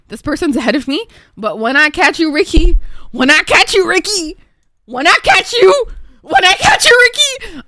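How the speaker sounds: hammed-up threat building to a high shout; escalating mock-furious yelling. Mock threat to person ahead.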